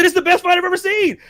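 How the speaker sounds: high-pitched fan's exclamation; amazed excitement. Retold or imitated. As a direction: relive the thrill, pitch way up. Reliving amazement at the fight.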